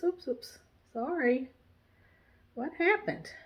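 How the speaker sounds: ordinary talk, nothing marked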